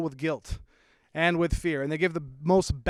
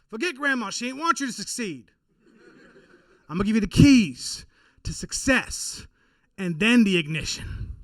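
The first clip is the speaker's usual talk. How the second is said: [name] GPS voice; 5 semitones higher, 4 dB louder than usual. Retold or imitated. Comic imagining GPS, mock [name] boast. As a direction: boast like a hype-man sat nav. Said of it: "him being the voice of your GPS".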